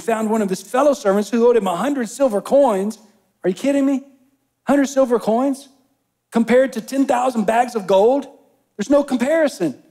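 climbing pulpit intensity; full-force preaching. Acted. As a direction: preach it hard with outrage.

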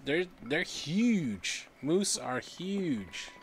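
slow, stretched, loud emphasis on huge; awed and drawn out. Real, not acted. Player awed by the huge moose, slow and stretched. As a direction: draw out the words, amazed at the size.

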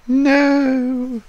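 high, loud screaming no. Retold or imitated. Girlfriend screaming as the monster lifts her boyfriend. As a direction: shriek it in horror.

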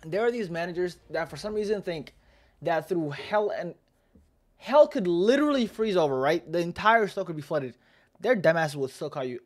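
worked-up venting about bosses; exasperated rant. Real, not acted. Ranting about unreasonable work managers.

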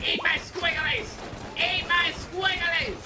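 high, silly taunting cry; high-pitched goofy war cry; battle taunt. Real, not acted. Taunting enemies while firing.

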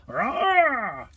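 mock animal roar, high; panda roar. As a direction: growl like a toy panda. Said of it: "what do pandas sound like".

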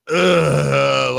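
long groan of mock annoyance; fed-up, drawn-out "ugh"; exasperated groan. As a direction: groan as if it grates on you. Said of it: "I'm like".

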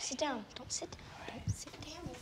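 hushed whisper to sisters. Real, not acted. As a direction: keep it secret, whisper. Hushed setup before mom's surprise.

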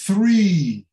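three with a long, falling ee; long I sound. Shown as a demonstration. Teacher giving an example of the I sound, Three with long ee. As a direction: stretch the ee in Three. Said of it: "palavras têm este som".

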